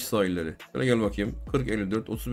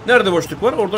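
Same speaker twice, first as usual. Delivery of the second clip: urgent shout; 7 semitones higher, 7 dB louder than usual. Real, not acted. Gamer hunting space to shoot.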